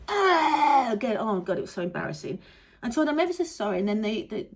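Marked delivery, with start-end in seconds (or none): 0.1-0.9 high, loud barked "Ah!"; driver's loud shout. Retold or imitated. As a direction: snap at her again. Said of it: "And he went".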